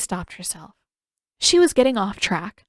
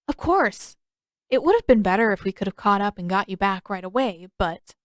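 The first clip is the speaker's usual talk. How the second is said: put-on young Aang. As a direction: play Aang as an eager young boy. Aang voiced in story.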